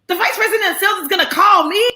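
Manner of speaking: high, fast, laughing disbelief; surprised squeal. Retold or imitated. Told the sales VP will call, high laughing disbelief. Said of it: "I said".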